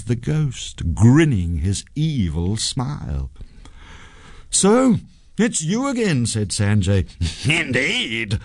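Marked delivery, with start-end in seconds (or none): 4.5-6.3 high-pitched confrontational greeting; accusing young hero, high voice. A narrator's reading. Sanjay confronts the ghost again. 7.5-8.4 gloating ghost, higher voice; gleeful evil spirit; laughing. A narrator's reading. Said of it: "laughed the ghost".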